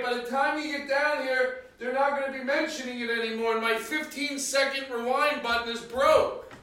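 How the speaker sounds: high wife voice calling upstairs; calling from far away. Acted. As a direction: shout up to someone in another room.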